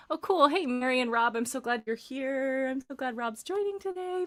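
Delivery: high singsong welcome, rising at the end; delighted greeting. Real, not acted. Coach spotting friends joining the class, delighted singsong. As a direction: greet them brightly, climbing in pitch.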